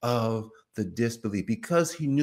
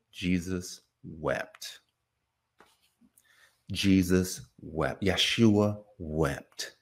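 very slow, low, hushed verse; solemn recital; 6 semitones lower, much slower than usual. Real, not acted. Preacher giving the shortest verse in the Bible, slow and solemn. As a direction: let each word land slowly and gravely.

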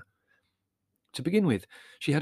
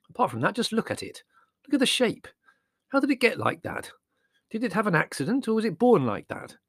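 Larry scoffing; 10 semitones higher than usual. A narrator's reading. Larry mocking the dog.

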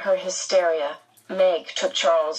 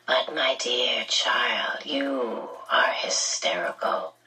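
low, slow, whispery villain voice; telepathic villain; 6 semitones lower, slower than usual. A narrator's reading. Red-eyed man thinks at frantic Meg, eerily hushed. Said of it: "the man thought at her".

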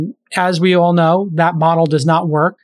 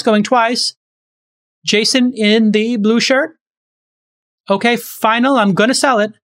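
auctioneer; 4 semitones higher than usual. Retold or imitated. Auctioneer calling for a higher bid, upbeat and higher. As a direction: hype the room, calling out bids. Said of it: "Like the auctioneers".

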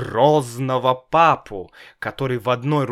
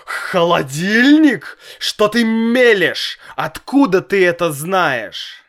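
shocked exclamation; 8 semitones higher, 7 dB louder than usual. A narrator's reading.

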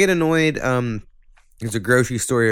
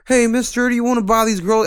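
quoting a salesgirl; 11 semitones higher than usual. Retold or imitated. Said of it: "when they're like".

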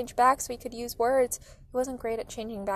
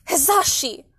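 loud, higher cry of a name; sharp raised-voice call; yelling; 5 semitones higher, 9 dB louder, slower than usual. A narrator's reading. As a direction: yell the name in outrage. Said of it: "Mom yelled".

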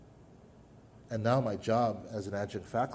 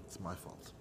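quiet; 5 semitones lower, 16 dB quieter than usual. Retold or imitated. Admitting failure to father.

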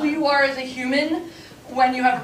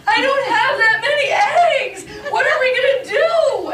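mock sad whine; 11 semitones higher, 4 dB louder than usual. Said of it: "then she gets really sad".

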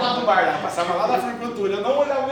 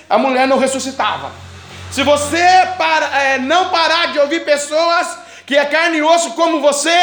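forceful preaching, higher and louder; fiery sermon build; 5 semitones higher, 8 dB louder than usual. Acted. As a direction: drive it home to the congregation.

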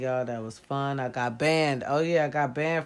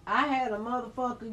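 ordinary talk, nothing marked

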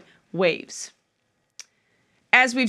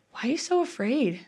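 soft, gentle asking, much quieter; calm question; 7 dB quieter than usual. Acted. Jesus calmly asking frightened disciples, soft calm question. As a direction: ask it calmly, unworried. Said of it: "And he calmly asks them".

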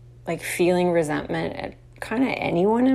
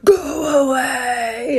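slow, loud, higher wailing monster cry; 8 semitones higher, 6 dB louder, much slower than usual. Pretend. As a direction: wail it out to scare a little brother. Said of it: "I would do [name]".

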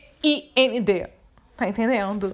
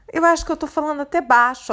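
ordinary delivery

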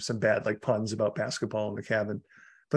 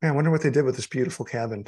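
ordinary talk

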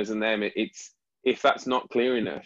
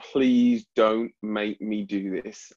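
pleading; 4 dB louder, much slower than usual. Retold or imitated. Soldier's imagined silent plea.

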